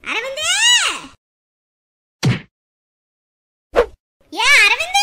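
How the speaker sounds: loud, high rising call. Acted. Mother calling Aravind, who is not answering, calling out. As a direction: holler the name across a distance. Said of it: "எம்புட்டு நேரம் கூப்பிட்டுக்கிட்டு இருக்கேன்".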